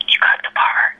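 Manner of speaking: a secret whisper, no voice at all; hushed news that he's cast. Retold or imitated. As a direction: lean in and breathe it close. Leaned over to him and said.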